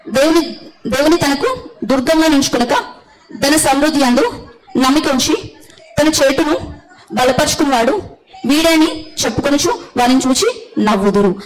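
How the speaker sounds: lifted, measured reading of the verse; scripture reading. Acted. Preacher reading a verse to the congregation, lifted reading voice. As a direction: read the passage out to the church.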